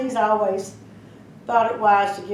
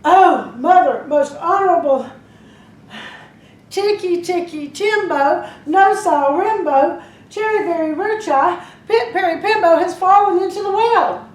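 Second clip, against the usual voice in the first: big breath, loud call; 7 semitones higher, 6 dB louder than usual. Acted. Boy shouts the name again to his mother, loud and high. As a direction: take a deep breath and shout it all. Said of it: "took a deep breath".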